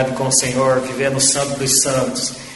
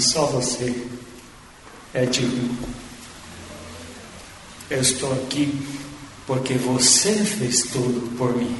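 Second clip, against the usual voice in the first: soft, lowered voice, with long pauses; understated, humble quote, quietly spoken; hushed reverence; 3 semitones lower, 7 dB quieter than usual. Retold or imitated. Preacher voicing a crowned pastor before Jesus, hushed reverence.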